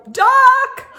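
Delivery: very high, loud shout of one word; yelled warning. Acted. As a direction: bark the warning as the crash comes. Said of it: "So McGee yelled".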